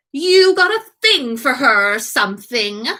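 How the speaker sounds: ordinary delivery, nothing marked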